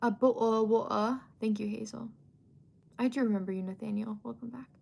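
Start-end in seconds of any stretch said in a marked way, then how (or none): 0.0-1.2 glottal-stopped mock British accent; playful British accent; put-on British accent. Shown as a demonstration. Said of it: "I know you don't say that".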